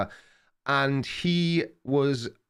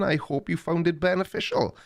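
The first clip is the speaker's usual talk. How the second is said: normal talking voice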